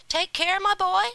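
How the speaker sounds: high, loud fatherly call. A narrator's reading. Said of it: "he said".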